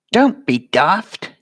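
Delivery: loud, slow, firm rebuke; loud retort. Real, not acted. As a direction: snap her out of it, loud. Sharp retort to pity talk.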